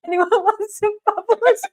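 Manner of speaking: high, loud squeal through laughter; laughing quote. Retold or imitated. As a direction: quote the waitress while cracking up. Retelling waitress's line through laughter.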